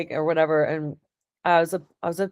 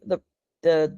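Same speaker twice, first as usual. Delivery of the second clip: usual speaking voice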